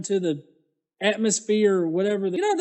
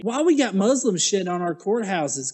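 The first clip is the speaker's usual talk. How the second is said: usual speaking voice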